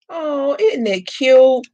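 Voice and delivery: high, slow, delighted coo; fond cooing over a finished piece. Real, not acted. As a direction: coo over it like something adorable. Admiring the freed resin piece.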